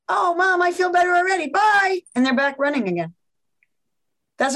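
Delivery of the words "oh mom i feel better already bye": put-on high, bright kid voice; a little kid, cheered by mom, chirpy and high; cheerful child. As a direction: play the kid skipping off happy.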